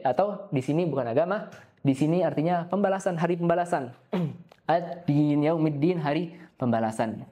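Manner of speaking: ordinary talk, nothing marked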